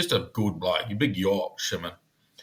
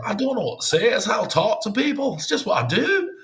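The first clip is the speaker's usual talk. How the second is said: high-pitched mock plaintive protest; high, hammed-up helpless self-defence; imitated protesting colleague; 12 semitones higher than usual. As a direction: imitate a bloke pleading his case, voice way up. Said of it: "he's at the back going".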